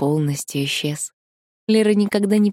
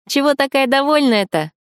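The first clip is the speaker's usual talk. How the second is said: put-on much higher, chirpy girlfriend voice; bright friend voice; 9 semitones higher, 4 dB louder than usual. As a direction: pop in curious and high. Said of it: "спросила Таня".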